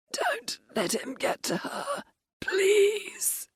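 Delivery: begging with his last breath; wheezing plea. Acted. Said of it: "the Viscount wheezed".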